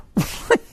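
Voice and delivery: a quick amused chuckle; airy chuckle. Real, not acted. Amused at his own point.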